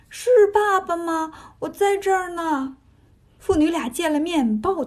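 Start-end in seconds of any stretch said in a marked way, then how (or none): 0.1-2.8 high, drawn-out answering call; calling back. A narrator's reading. Daughter answers her father from inside, calling back high. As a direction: call back from behind a door, slowly. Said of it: "听见里面有人答话".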